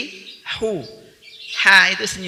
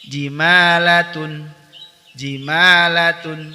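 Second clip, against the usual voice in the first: loud, clear model recitation; recitation drill; 2 semitones higher, 7 dB louder, much slower than usual. Shown as a demonstration. Tahsin teacher modelling words for students, loud clear recitation. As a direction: recite each word loudly for students to echo.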